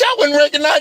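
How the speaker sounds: ordinary talk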